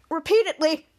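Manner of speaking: ordinary delivery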